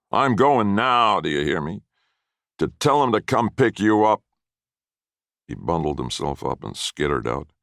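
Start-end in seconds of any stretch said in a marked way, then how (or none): 0.1-1.2 higher, much louder and very slow; drawn-out shout. A narrator's reading. Grandfather repeating his warning to the boy, slow and shouted. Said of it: "mean, nasty threats". 1.2-4.2 put-on higher and louder, nasty old man; angry threat. A narrator's reading. Grandfather finishing his threat to the boy, angry. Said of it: "mean, nasty threats".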